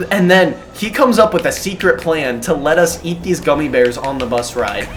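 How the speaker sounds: normal talking voice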